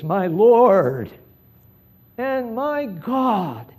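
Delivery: rising, drawn-out cry of awe; emphatic, exclaimed, passionate. Retold or imitated. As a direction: exclaim it like a doubter who suddenly sees. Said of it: "You can almost just hear Thomas say this".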